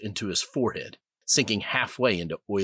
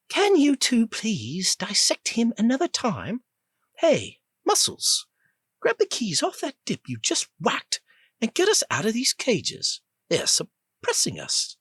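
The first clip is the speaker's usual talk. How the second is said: put-on high, bossy British-accented orders; the caged woman bossing Rip to free them; impatient British woman. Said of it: "She had a clipped accent that sounded vaguely British".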